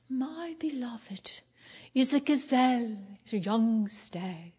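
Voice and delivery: breathy, slow, hushed reading of the verse; soft dreamy reading. Shown as a demonstration. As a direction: read it soft and slow, lovey-dovey. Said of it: "I always hear that verse read like this".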